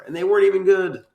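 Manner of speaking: high, loud exclamation of disappointment; exasperated outburst. Real, not acted. Co-host griping about the monster fights, exasperated. Exasperated complaint, then resigned repeat.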